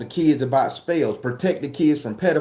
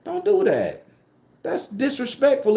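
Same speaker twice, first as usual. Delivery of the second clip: exasperated outburst; 9 semitones higher than usual. Real, not acted. Telling off disrespectful commenters, exasperated high outburst. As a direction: pitch jumps much higher on "do".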